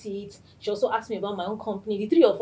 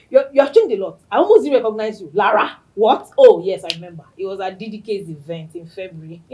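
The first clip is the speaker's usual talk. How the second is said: louder, lively imitation of her friend; imitating Stephanie; 12 dB louder than usual. Host mimicking her friend's surprised greeting, louder and lively. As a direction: play her quick, outspoken voice. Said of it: "You people know how Stephanie talks".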